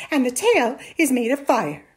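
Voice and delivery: loud, exasperated scoff; mock the tall tale. A narrator's reading. Mother doubts her son's story, scoffing.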